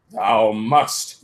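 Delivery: lightly voiced dark lord insisting; mock villain yell. A narrator's reading. Voldemort insists, mild villain yell. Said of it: "he yelled".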